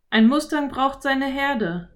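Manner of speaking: put-on a clever boy explaining; boy Philipp. A narrator's reading. Philipp voiced. (Jungenstimme, erklärend)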